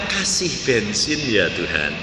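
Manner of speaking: soft, low murmured prayer; hushed plea. Retold or imitated. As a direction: murmur the plea under the breath. Mimicking a whispered plea. (doa lirih dan pelan)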